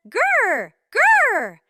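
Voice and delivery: bear growl. Acted. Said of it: "The bear says".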